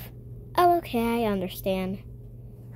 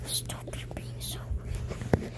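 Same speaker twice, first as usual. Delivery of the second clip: slow whispered scold; 9 dB quieter, slower than usual. Acted. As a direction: hiss a quiet telling-off. Mom hushes rude kid.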